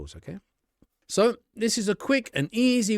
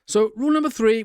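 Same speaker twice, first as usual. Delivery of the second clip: normal talking voice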